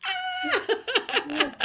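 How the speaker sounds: high squealing "Ah!" with a laugh; excited squeal. Retold or imitated. Franchisor squealing with joy as the winner is called. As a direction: squeal it like a thrilled cheerleader. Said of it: "Mel was like".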